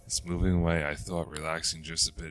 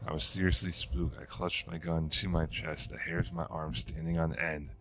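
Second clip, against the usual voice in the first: ordinary delivery, nothing marked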